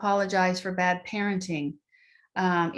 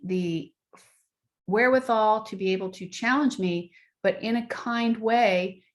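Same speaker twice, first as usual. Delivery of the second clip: ordinary talk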